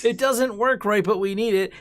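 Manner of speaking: whiny mock insistence. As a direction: whine like a spoiled kid. Mocking Detroit police.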